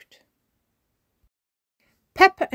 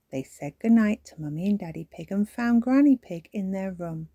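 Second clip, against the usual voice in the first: usual speaking voice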